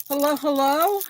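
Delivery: ordinary delivery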